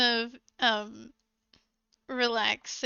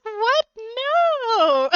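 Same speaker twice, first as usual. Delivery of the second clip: much higher, louder shocked cry; horrified girlfriend; 10 semitones higher, 8 dB louder than usual. Retold or imitated. Girlfriend refuses the third cousins excuse, horrified shriek. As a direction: recoil in disbelief. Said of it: "she's like".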